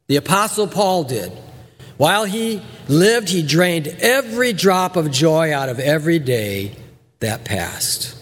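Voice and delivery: ordinary talk, nothing marked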